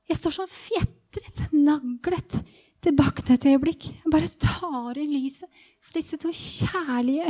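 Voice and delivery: slow, wonderstruck telling with pauses; awed first-person storytelling. Acted. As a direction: marvel at seeing for the first time. Dramatized moment of regaining sight.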